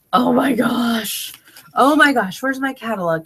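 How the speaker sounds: retold shock. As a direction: replay the moment of shock. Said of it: "I'm looking at my packing slip going".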